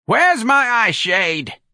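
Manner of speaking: slow, high, loud snarl; snarling old woman. A narrator's reading. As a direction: screech it out as an enraged old woman.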